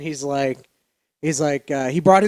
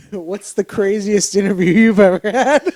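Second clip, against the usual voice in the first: asked through a laugh, louder; can't hold the giggle back; laughing while reading the note aloud; laughing question; 4 semitones higher, 6 dB louder than usual. Real, not acted.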